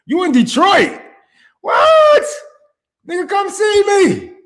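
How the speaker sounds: high, loud burst of hometown joy; hyped shout to a Detroit viewer; excited shout. Real, not acted. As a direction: yell it out, thrilled to meet a local.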